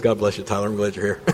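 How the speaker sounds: ordinary talk, nothing marked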